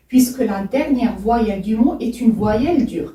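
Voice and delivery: normal talking voice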